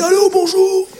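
failed macho phone voice, squeaking up; a botched tough-guy hello on the phone; attempted gruff voice. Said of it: "prendre une grosse voix au téléphone".

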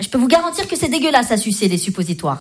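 usual speaking voice

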